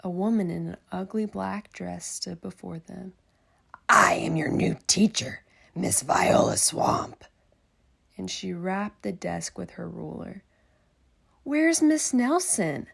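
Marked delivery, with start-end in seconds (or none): 3.9-7.2 loom over them like a witch; witchy teacher. Acted. Miss Swamp introducing herself to the class, loud sinister rasp. 11.4-12.9 loud, higher anxious question; worried kids. Acted. Kids asking where their teacher went, loud and high. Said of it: "asked the kids".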